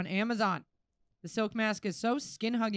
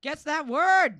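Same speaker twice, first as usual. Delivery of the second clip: game show host; 7 semitones higher, 10 dB louder, slower than usual. Pretend.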